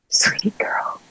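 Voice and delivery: soft whisper, gentle as a parent; whispered tenderly. Retold or imitated. God comforting her, a tender whisper. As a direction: breathe it out lovingly. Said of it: "And then God was like".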